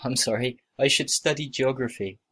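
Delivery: ordinary talk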